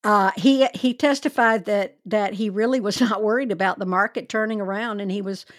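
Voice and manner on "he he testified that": ordinary talk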